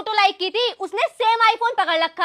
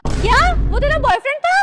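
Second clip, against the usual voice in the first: higher-pitched, startled question; shocked question; 6 semitones higher, 4 dB louder than usual. Acted. Woman learning the other's boyfriend is hers too, shocked. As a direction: blurt it out in disbelief.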